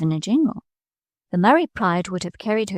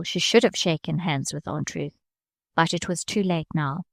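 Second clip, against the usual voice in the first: usual speaking voice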